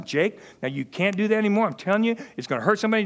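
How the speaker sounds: a hint of a stern father; lightly voiced fatherly scolding. Father warning his son Jake, mildly stern. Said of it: "and I'd be stern".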